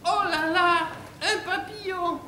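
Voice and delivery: high, drawn-out squeal of wonder; gushing bimbo. Acted. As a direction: coo it like a delighted airhead. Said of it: "elle fait". (voix de bimbo émerveillée)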